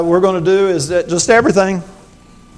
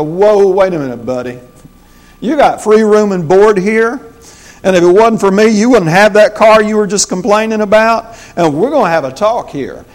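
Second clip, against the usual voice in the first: put-on loud, higher scolding of a sulky teen; stern parent rebuke; 6 semitones higher, 7 dB louder than usual. Parent scolding his moody teenager, loud stern rebuke. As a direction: lay down the law to the kid, raised voice.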